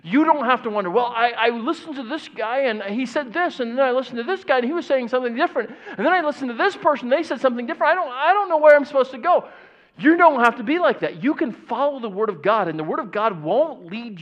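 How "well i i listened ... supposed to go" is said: rising higher and faster into mock panic; flustered confused believer. Mimicking a confused believer to the congregation, building mock panic. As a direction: pile up the confusion until it cracks.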